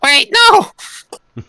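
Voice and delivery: high-pitched shouted protest; mock alarmed shout. Character dragged out of the building, high shouted protest. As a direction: cry out to stop them, very high.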